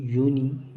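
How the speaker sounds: "यूनि" alone, loud, stressing the "yoo" sound; first syllable demo. Shown as a demonstration. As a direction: isolate "यूनि" to show the y-sound. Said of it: "ये वाई का आवाज़ दे रहा है".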